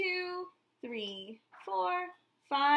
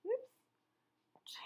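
a quick whispered slip-up; whispered oops; 10 semitones higher, 9 dB quieter than usual. Acted. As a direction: breathe it out as a secret little mistake. Teacher catches her own slip.

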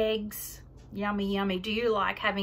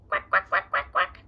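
loud quacking; duck quacking; 7 dB louder than usual. Pretend. Duck sound for child.

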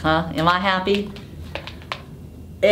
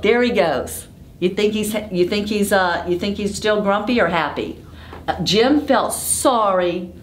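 usual speaking voice

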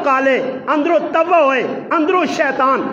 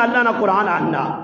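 ordinary delivery

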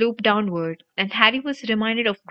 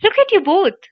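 loud, very high alarmed cry; high-pitched exasperated yell; indignant shriek; 10 semitones higher, 7 dB louder than usual. A narrator's reading. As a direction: shriek it at two friends in danger. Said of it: "shrieked Hermione".

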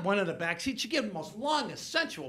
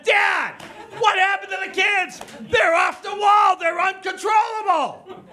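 high, drawn-out exasperated yell; hammed-up outraged son; frantic angry shout; 12 semitones higher, 10 dB louder than usual. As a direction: yell down the phone at Dad. Son phones, upset about wild kids.

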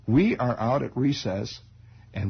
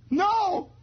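put-on very high yelp of a boy; squeaky kid denial. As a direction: blurt the denial. Kid denies to father.

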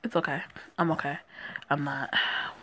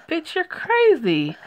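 shocked; 10 semitones higher, 4 dB louder, slower than usual. Real, not acted. Commentator reacts to gun scene.

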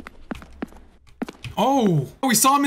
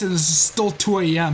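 ordinary talk, nothing marked

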